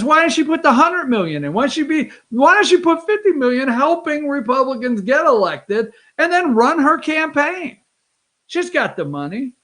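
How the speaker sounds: lively mock proposal; quoted pitch. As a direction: pitch it like an eager schemer's question. Voicing Gaetz's pitch in retelling.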